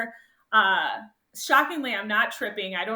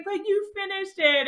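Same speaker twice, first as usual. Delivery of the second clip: high, insistent comfort; consoling; 10 semitones higher, 3 dB louder than usual. Retold or imitated. Friend consoling the runner, high reassuring voice. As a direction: reassure her she still made it. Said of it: "And she's like".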